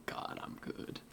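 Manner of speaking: smug little whisper to herself; whispered brag. Real, not acted. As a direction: breathe it out pleased with herself. Whispered brag after distraction.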